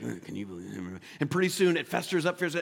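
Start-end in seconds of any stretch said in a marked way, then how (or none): 0.0-1.0 low, soft mock muttering; imitating a gossipy grumble; grumbling. Shown as a demonstration. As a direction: mumble a complaint under your breath. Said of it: "all of a sudden we can be like".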